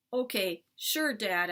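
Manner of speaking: ordinary delivery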